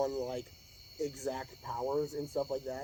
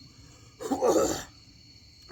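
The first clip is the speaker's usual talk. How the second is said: loud hacking cough from spice; choking cough, throat burning; coughing fit; 6 semitones higher, 7 dB louder than usual. Real, not acted. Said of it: "it's all coming up".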